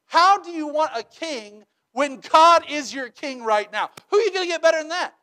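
exasperated prophet, rushing at the end; upset Samuel. Retold or imitated. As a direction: Samuel scolding the people, getting worked up. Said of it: "Samuel's upset".

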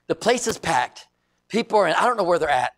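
ordinary delivery

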